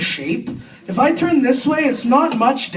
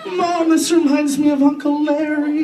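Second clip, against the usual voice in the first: put-on high child voice; small kid, pitched way up; little sister; 7 semitones higher than usual. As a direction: call to Mom in a high little voice. Young sister reacting to creepy doll.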